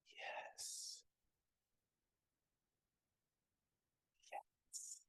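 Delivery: barely audible whispered yes; tiny whispered reply. Retold or imitated. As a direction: breathe it like a shy little boy. Child answers shyly, quietly.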